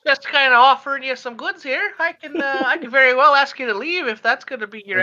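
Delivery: put-on high, huffy old lady voice; prim and put out, threatening to throw them out; offended old lady. Shopkeeper scolds laughing Daniel, high and huffy.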